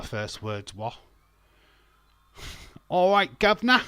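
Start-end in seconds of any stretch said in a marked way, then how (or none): 2.9-3.8 loud, high mock Cockney greeting; over-the-top Cockney accent; hammed-up Cockney. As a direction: holler it like a cartoon Cockney. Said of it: "make her sound like she's Cockney".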